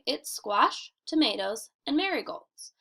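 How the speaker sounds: normal talking voice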